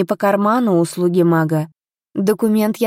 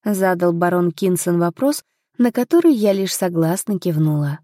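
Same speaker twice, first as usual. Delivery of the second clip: usual speaking voice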